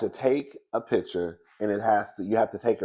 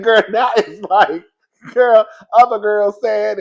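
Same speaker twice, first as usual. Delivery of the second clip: high, loud excited ranting; high, loud sassy rant about the cheater; heated rant; 11 semitones higher, 11 dB louder than usual. Real, not acted. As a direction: go off on it, full sass.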